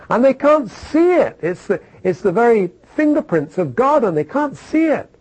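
rising preacher's exasperation; exasperated. Real, not acted. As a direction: cry out in disbelief.

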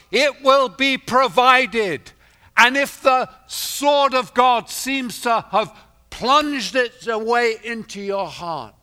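loud, high, ringing sermon voice; rising pulpit proclamation; full-force preaching. Acted. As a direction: preach it out to the whole hall.

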